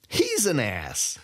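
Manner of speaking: put-on gloating thief greeting an old rival; gleeful Sai. A narrator's reading. Sai voiced by narrator.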